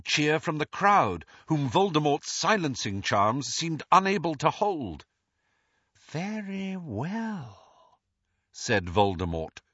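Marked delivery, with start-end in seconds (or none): 6.1-8.0 very soft, slow, high silky threat; soft slow dangerous purr; silky menace. Acted. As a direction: menace wrapped in silk. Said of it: "the silkiness of his voice".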